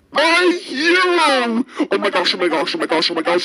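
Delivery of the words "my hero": high, slow, starstruck exclamation; awestruck cry. Acted. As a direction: gasp it out like meeting your idol. Rhino meets his idol.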